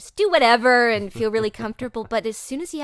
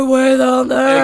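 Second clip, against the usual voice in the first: slow, very loud drawn-out cartoon voice; way-down-there demo; 4 semitones higher, 14 dB louder, slower than usual. Shown as a demonstration. Actress demonstrates cartoon range, slow booming drawl. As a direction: stretch it out big and loud.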